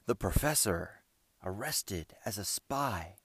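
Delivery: slow, choked-up repeat of bad news; catch in his voice. A narrator's reading. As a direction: let the voice catch on the words. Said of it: "with a catch in his voice".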